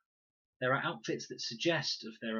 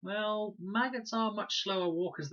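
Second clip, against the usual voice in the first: high, jokey lightness; forced joke; 13 semitones higher, 4 dB louder than usual. A narrator's reading. Boy joking to an unsmiling girl, high and forced. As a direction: try to joke away the tension. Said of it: "Fred tried to grin".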